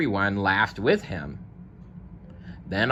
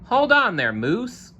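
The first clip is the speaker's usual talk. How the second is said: high, louder call; 14 semitones higher, 3 dB louder than usual. Acted. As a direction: call across to stop him. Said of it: "called Zebra".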